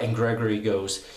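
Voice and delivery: usual speaking voice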